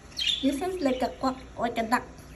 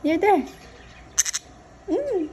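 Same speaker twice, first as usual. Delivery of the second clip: ordinary talk, nothing marked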